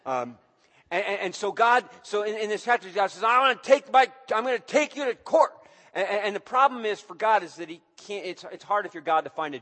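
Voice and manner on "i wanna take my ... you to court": put-on loud, high, fed-up threat to take his children to court; exasperated God. Preacher voicing God as a fed-up parent, loud and exasperated. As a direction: voice God losing patience with his kids.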